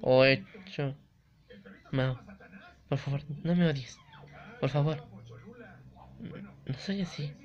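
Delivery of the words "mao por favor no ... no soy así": soft pleading. Acted. Yuri begging, voiced softly.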